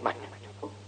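voiceless, very soft reply; sheepish admission. Acted. Abu Bakr unable to answer the scholar, sheepish admission. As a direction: drop to a near-whisper to admit it.

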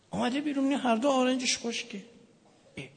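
usual speaking voice

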